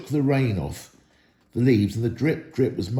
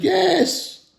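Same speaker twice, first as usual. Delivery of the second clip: yes leaping far higher; eager yes; 3 dB louder than usual. A narrator's reading. Dwarves arguing, one side cries out eagerly. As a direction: pop the yes up high. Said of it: "and some said".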